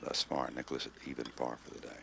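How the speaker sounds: usual speaking voice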